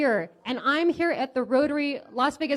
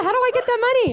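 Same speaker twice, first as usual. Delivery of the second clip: high, loud, laughing mock of her squeal; laughing imitation of the winner's squeak; imitating the winner; 12 semitones higher, 6 dB louder than usual. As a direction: parrot her excited question back, much higher and louder. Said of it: "She said".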